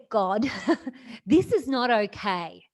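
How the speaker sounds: loud, raw protest with a laugh breaking in; angry at God. Retold or imitated. Daughter yells at God over her mother's cancer, loud and angry. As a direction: shout it up at God, half laughing. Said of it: "I got really angry at God".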